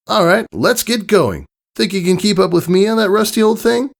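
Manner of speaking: high cartoon pony taunting a friend; teasing Rainbow Dash. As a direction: throw down a cheeky dare. Rainbow Dash teases Scootaloo.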